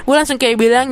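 normal talking voice

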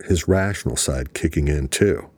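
ordinary delivery, nothing marked

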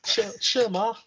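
high-pitched mock Scouse; Scouse accent. Host echoes guest's joke, high Scouse accent. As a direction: echo it as a throaty Scouser.

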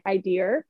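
"idea" ending in an R, as "I dear"; New Hampshire added R. Shown as a demonstration. Retelling comedian's R replacement joke, "I dear" with added R. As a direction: tack an R onto idea: "eye-DEER". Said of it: "in New Hampshire we'll th-, say things like".

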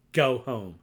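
real sharp loud order; shouting go home at the politician; angry shout. Real, not acted. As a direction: bark it out like an angry command. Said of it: "that's how angry I am".

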